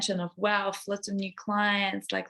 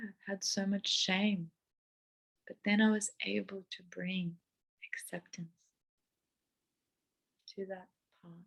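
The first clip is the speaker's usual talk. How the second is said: much softer, tender and exposed; vulnerable hushed; 2 semitones lower, 9 dB quieter than usual. Real, not acted. Vlogger sharing her fear openly, soft vulnerable voice. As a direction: speak it gently as a hard truth. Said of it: "scary and vulnerable to share".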